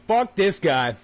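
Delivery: mock curse. Imagined owner curses his player.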